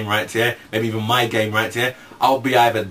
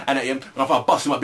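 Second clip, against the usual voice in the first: ordinary delivery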